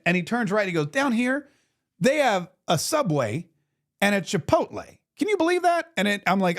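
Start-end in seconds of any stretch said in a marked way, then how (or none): 0.9-5.9 lightly voiced congressman, amazed, rising high on the question; a hint of boyish wonder at the food court; excited congressman. Congressman showing off the Subway to the host, pitched up and amazed.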